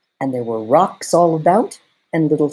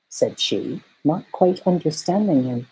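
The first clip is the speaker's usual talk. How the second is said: ordinary delivery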